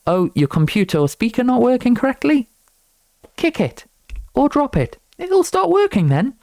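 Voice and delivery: high, silly mock voice for the old saying; playful high-pitched parody of a know-it-all. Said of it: "there's this old saying".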